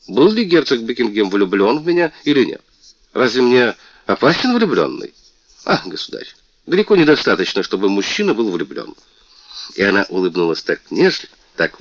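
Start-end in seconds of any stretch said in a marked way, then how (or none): 0.1-8.9 высокий, звонкий голос обиженной принцессы; жалуйся, как оскорблённая принцесса; мультяшная принцесса. Acted. Принцесса спорит с королём. (принцесса жалуется королю, звонко и обиженно)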